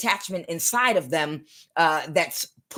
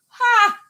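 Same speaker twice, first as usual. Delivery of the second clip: sharp laugh; 7 dB louder than usual. Real, not acted. Laughing at the death spell.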